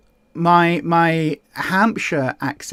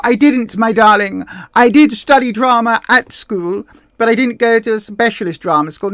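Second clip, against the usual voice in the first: louder, hammed-up drama-school darling; theatrical luvvie; 3 semitones higher, 4 dB louder than usual. Answering whether they went to drama school, hammed-up luvvie. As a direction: play the grand actor, louder and lofty.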